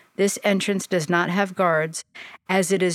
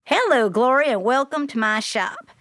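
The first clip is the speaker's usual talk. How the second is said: put-on Southern accent; 6 semitones higher than usual. A narrator's reading. Sophia welcomes Glory to her shop, Southern accent. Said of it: "In a thick Southern accent".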